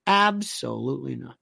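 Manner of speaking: low, deliberate repeat with "Absolutely" lengthened. Real, not acted. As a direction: draw out "Absolutely" in a low, firm denial. Repeats answer drawn out for emphasis.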